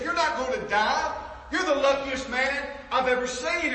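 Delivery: loud, high retort to a prisoner; guard's emphatic announcement. Acted. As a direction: shout the unbelievable news at him. Guard shouts news to condemned prisoner.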